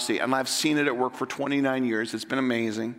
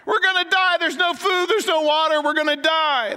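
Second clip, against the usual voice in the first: panicked screaming; 4 dB louder than usual. Acted. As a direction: scream it like a man sure he's about to die. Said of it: "starts screaming and yelling".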